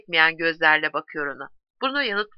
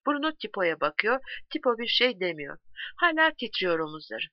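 plain reading voice

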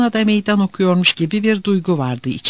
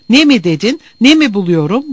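loud, higher, rising indignant questions; furious repeat; 5 semitones higher, 6 dB louder than usual. A narrator's reading. Divitoğlu echoing the question put to him, furious. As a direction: throw the question back angrily. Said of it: "İyiden iyiye öfkelenmişti".

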